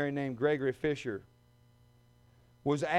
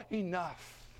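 ordinary delivery

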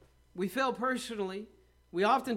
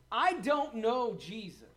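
emphatic mock denial; 3 semitones higher, slower than usual. Acted emphatic oath denying Jesus.

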